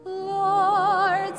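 ordinary talk, nothing marked

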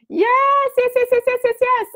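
high-pitched string of yeses; excited cheering. Real, not acted. Host celebrating with viewers, excited cheering. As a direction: squeal a run of delighted yeses.

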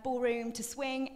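normal talking voice

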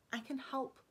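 soft, hushed plea; whispered. Acted. Alex softly whispering to Stefan that she can help. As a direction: whisper it so only he hears. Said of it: "she whispered".

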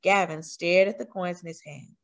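ordinary delivery

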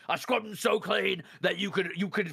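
loud, slow, mock outrage; hammed-up rant. Real, not acted. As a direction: shout the boast, laughing. Playful exaggerated rant.

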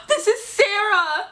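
high, drawn-out mock-tearful gasp; crazy fangirl. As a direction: announce yourself as an overwhelmed fan. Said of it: "pretending like I'm some crazy fangirl".

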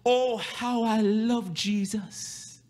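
very high, loud, sung-out preaching; impassioned preaching. Acted. Pastor calling out to the congregation in a high, singing preach. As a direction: lift it into a singing cry of worship.

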